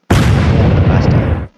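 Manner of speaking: loud whispered cheer. Real, not acted. Celebrating in game.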